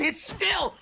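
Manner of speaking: high, loud, drawn-out call; excited play-by-play. Real, not acted. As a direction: call the play big and slow. Caster calling a close fight.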